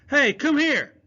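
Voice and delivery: loud, high call after the bird; shouting up at a bird in the sky. Acted. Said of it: "he called".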